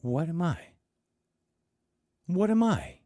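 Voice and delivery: high rising panic; panicked. Acted. Trembling Ted asking the class, panic building. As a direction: repeat it in growing panic.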